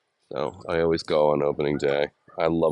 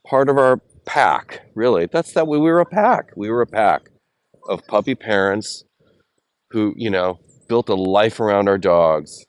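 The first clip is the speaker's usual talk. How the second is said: louder, voice straining with grief; emotional; 4 semitones higher, 6 dB louder than usual. Real, not acted. Grieving friend's lost dog.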